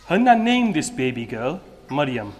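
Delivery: ordinary delivery, nothing marked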